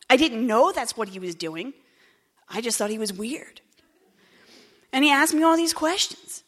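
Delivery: usual speaking voice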